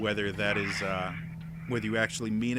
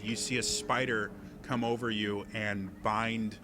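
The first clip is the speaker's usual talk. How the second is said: ordinary talk, nothing marked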